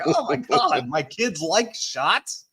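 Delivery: high, incredulous question, slowing at the end; amazed disbelief. Retold or imitated. Dad marvelling at his kids after shots, amazed disbelief.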